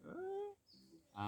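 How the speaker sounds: surprised yelp. Real, not acted. Passer-by interrupts again.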